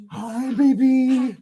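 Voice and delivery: high, loud, slow sing-song. Real, not acted. Dad greeting his baby daughter, high loud sing-song.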